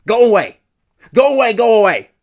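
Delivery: very high, very loud yell. A narrator's reading. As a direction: scream them away. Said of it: "this time much louder".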